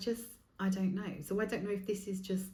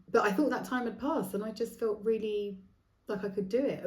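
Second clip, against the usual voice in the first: ordinary talk, nothing marked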